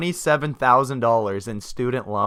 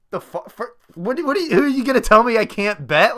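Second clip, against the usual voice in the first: indignant outburst; 9 semitones higher, 3 dB louder than usual. Retold or imitated. Retold outburst at kiosk lady.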